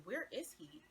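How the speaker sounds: high-pitched questioning, imitating herself searching; retold urgent question. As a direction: ask it with a big lift in pitch. Retold frantic search for the guy.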